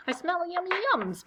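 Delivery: greedy badger. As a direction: relish the smell of treats.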